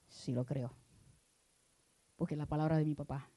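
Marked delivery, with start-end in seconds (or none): 0.1-0.7 más bajo y suave, convencido en voz queda; respuesta suave del creyente. Acted. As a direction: contesta en voz baja, con calma firme. Answers as a believer. (el creyente responde al pastor en voz baja)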